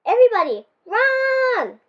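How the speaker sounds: high, loud cry to run; panicked shout. A narrator's reading. A friend warns everyone to run from the slime, shouting. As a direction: yell a warning to flee.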